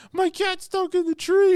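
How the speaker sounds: put-on very high, whiny child voice; little girl. Little girl asking for help with her cat, very high and whiny. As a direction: pipe it up like a crying kid.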